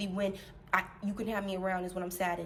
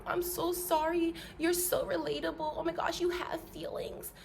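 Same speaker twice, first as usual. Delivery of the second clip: put-on pitying voice, pitched up; gushing mock sympathy; 7 semitones higher than usual. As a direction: coo it like a fawning follower. Mimics gushing sympathy of followers.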